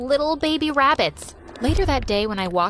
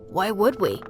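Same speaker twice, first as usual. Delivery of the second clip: lower, shy schoolboy; teen boy voice; 4 semitones lower than usual. Acted. Schoolboy telling Mia the boys find her pretty, lower voice. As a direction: lower your voice like a nervous boy.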